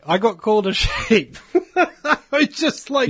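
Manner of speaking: laughing through the words, pitch jumping up; amused, breaking into laughter. Real, not acted. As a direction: giggle it out in disbelief. Laughing at being called sheep.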